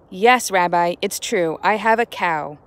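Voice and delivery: ordinary talk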